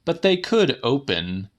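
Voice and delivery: plain reading voice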